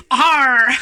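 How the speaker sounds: put-on loud playful toddler "arr"; imitated toddler growl. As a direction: copy the kid's sudden growl, loud. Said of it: "he just comes over and he just goes".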